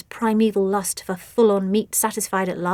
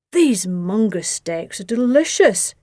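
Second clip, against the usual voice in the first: slow growling monster voice; a creature savouring its meal, slow and growly; growled; 4 dB louder, slower than usual. A narrator's reading. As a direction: growl it out slowly like a beast. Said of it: "growled Clifford".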